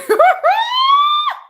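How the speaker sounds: high, loud burst of laughter; shrieking laugh. Real, not acted. Reacting to a viewer's cheeky comment, loud shrieking laugh.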